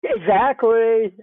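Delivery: drawn-out, high, loud agreement; emphatic agreement. Real, not acted. Listener seizing on the other speaker's point, emphatic agreement. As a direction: stretch it out as wholehearted, vocal agreement.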